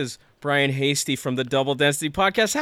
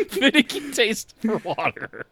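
laughing; 7 semitones higher than usual. Real, not acted. Laughing while quoting synopsis.

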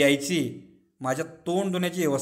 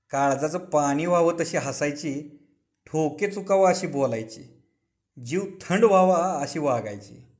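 ordinary delivery, nothing marked

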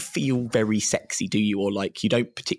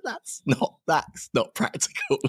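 incredulous; 7 semitones higher, slower than usual. Retold or imitated. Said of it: "And I was like".

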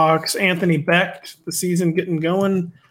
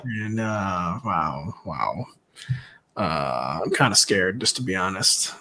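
mock dread; 8 semitones lower, 7 dB quieter, slower than usual. Mock dread at best ball.

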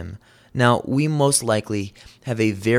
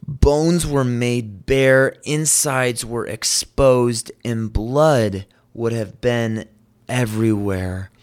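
slow and grave; slower than usual. Acted. Describing the scourging.

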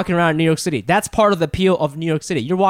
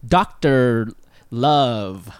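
sultry Doctor Love; 7 semitones lower, much slower than usual. Acted. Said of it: "Why do you say love that way".

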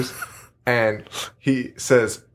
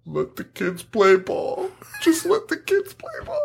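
imitating Kevin Smith crying. Retelling a podcaster's sobbing movie quote to a co-host, mock crying falsetto. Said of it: "he's crying while he's reciting it".

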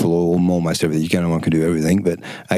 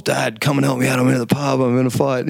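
higher, whiny son calling for help; mock panicked kid; 7 semitones higher than usual. Mates' sons ringing dad from a pub fight, mock panicked. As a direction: play the kid in trouble at the pub. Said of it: "they're getting calls".

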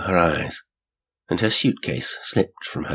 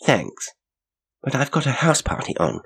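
firm retort, pitch climbing; 8 semitones higher than usual. A narrator's reading. Janet to the young man advising her to wait, retorting. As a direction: turn the advice down smartly. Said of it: "she retorted".

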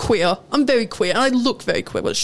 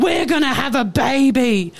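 slow, stretched-out mock yelling; retold screaming. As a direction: imitate a raving customer's drawn-out scream. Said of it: "Then she starts screaming again".

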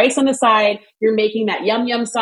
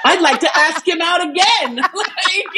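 laughing quote; 7 semitones higher than usual. Retold or imitated. Mock quote of her thoughts.

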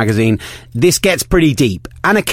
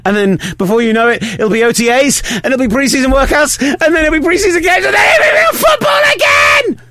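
real excitement climbing steadily to a high shout; building excitement; 14 semitones higher than usual. Real, not acted. Host counting down to the new football season, excitement building to a shout. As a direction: get carried away, higher with each item, ending yelled. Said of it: "Got excited".

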